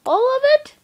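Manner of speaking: high squeak of disbelief; shocked protest. Pretend. Shocked at whole math book.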